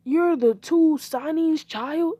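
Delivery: high, loud, slow incredulous question; astonished. Acted. Teacher asks the boy about his parents, astonished. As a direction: stretch each word in loud disbelief. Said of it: "He would be astonished".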